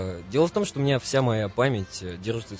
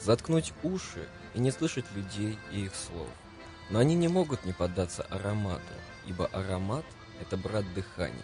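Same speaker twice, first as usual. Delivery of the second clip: dramatic literary reading; 6 dB quieter than usual. Acted. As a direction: read it like a radio poem. Reads Süskind quote over music.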